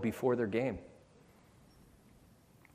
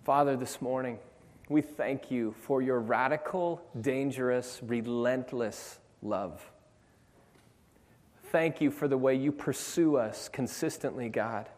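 rising, fervent prayer over music; 3 semitones higher, 5 dB louder than usual. Acted. Pastor praying over the congregation, building to fervent. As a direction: pray with growing fervour.

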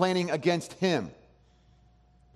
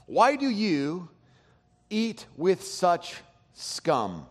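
drawn out, slow and sneering; slow scornful reading; 3 semitones higher, much slower than usual. Shown as a demonstration. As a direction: let the scorn hang on each word. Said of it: "some translations have it this way".

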